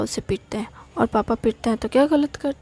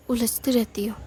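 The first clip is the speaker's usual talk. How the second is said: whisper. Acted. Brother mutters accusation.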